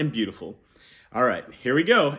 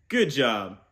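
normal talking voice

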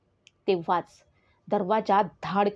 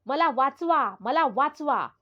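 higher-pitched, restrained yell; understated acted scream for help; crying for help; 4 semitones higher than usual. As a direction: call out desperately to be rescued. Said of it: "मी ओरडत होते".

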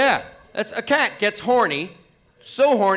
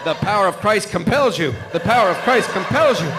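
loud hammed-up exorcism chant; mock exorcist shout; 3 semitones higher, 4 dB louder than usual. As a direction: cast out the devil, hammed up.

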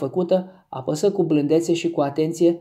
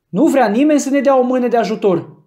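much higher, louder call for help; desperate shout; 7 semitones higher, 6 dB louder than usual. A narrator's reading. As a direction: yell desperately at the crowd. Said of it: "strigă Scott cu disperare".

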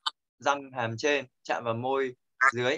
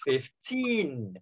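"Fifteen" with a long, raised second syllable; stress on TEEN. Shown as a demonstration. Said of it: "Trọng âm nó vào âm tin". ("Fifteen" nhấn, kéo dài âm "teen")

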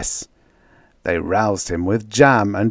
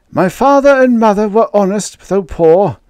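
high, loud, fretful baker voice; a nervous man pleading his story; baker's tale; 11 semitones higher, 7 dB louder than usual. Acted. Baker telling his woe to the crew, high and anxious.

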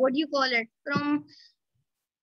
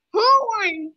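higher, louder eager question; 5 semitones higher, 5 dB louder than usual. Real, not acted. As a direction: demand to know who won. Waiting for results.

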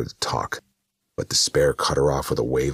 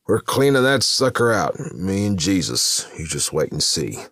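driver's boast; 7 semitones higher, 4 dB louder than usual. A narrator's reading.

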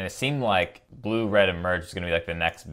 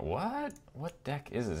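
high startled what; shocked what; 6 semitones higher, 9 dB quieter, slower than usual. Real, not acted. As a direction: yelp it out in disbelief. Shocked by opponent's Ulamog.

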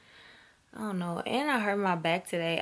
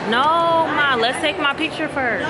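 loud protest to mom; 9 semitones higher, 5 dB louder than usual. Real, not acted. Arguing with mom for picture.

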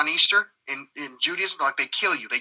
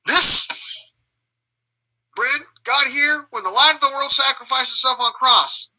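raised, intense sermon-like ranting; preachy rambling speech; 5 semitones higher than usual. Retold or imitated. To churchgoers, an intense preachy rant. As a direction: rant it like a wild street preacher. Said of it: "I just start blabbling".

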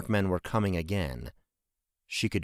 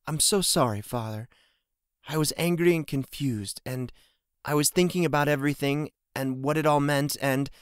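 higher-pitched, tearful apology; near tears; 6 semitones higher than usual. A narrator's reading. Daughter apologising to her father, near tears. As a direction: apologise as if about to cry. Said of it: "her voice cracked".

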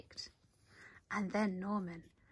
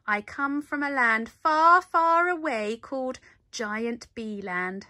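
louder, proud tall tale; Norman bluffing; 2 semitones higher, 8 dB louder than usual. A narrator's reading. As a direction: boast about a faraway land to cover up. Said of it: "said Norman".

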